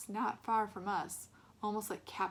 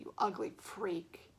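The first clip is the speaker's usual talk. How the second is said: menacing sneer; much slower than usual. A narrator's reading. Bully menacing.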